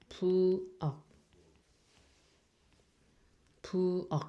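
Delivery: teacher models "pu o" again for learners; Korean syllables pu o. Shown as a demonstration. Teacher sounding out "pu o" for students.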